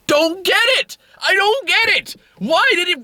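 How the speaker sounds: high squealed bafflement; exasperated meltdown. Real, not acted. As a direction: wail it in helpless disbelief. Breaking down over the scene again.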